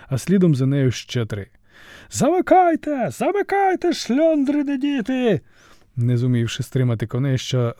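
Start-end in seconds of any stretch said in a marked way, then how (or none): 2.1-5.4 високий відчайдушний крик; кричить на ходу з колісниці; панічний наказ. A narrator's reading. Цар кричить, влітаючи у ворота. (цар у паніці кричить варті)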